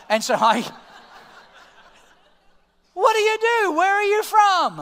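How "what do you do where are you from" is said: much higher and louder, slowed questions; hammed-up nosy seatmate; eager chatty stranger. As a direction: pepper him with questions like an excited passenger. Voicing chatty woman on plane.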